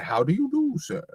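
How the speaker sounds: put-on "How do you do, sir?" in a high, prim British accent; posh British accent. A posh British accent for the toe handshake greeting. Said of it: "you have to say it with that accent".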